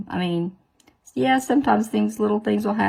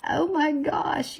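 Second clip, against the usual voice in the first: ordinary talk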